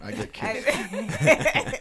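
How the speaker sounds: "I get kissed" drawn out high and airy; high, slow and breathy. Shown as a demonstration. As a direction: float "kissed" up slowly. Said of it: "Not, not burnt".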